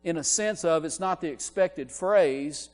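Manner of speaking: high, loud, emphatic preacher voice; impassioned preaching. Real, not acted. Preaching with rising intensity.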